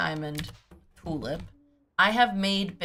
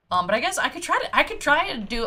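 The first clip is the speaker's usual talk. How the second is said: fast, louder, higher rush of words; excited rush; 7 semitones higher, 5 dB louder, faster than usual. Real, not acted. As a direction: hurry through the idea, getting excited. Rushing excitedly through plan.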